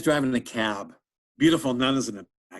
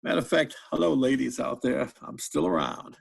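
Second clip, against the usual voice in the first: Bill Clinton. Acted. Comedian plays the president professing love for women, Bill Clinton impression. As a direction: savor each declaration of love like a flirtatious politician. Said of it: "doing Bill Clinton".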